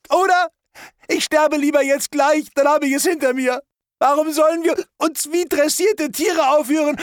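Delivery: high, loud tearful cry; bitter, tearful and shrill outburst; crying hysterically. Acted. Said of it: "es weinte". (hoch, laut, weinend und hysterisch)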